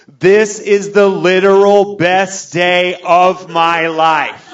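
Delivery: high, loud, drawn-out proclamation; mock-drunk ecstatic bellow; hammed-up drunken bawling. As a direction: wail it slowly in overblown joy. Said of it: "I am drunk. I am saying outlandish things".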